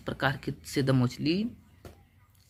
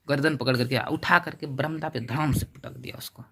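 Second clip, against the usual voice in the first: usual speaking voice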